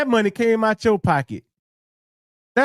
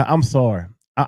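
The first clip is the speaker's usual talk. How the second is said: ordinary delivery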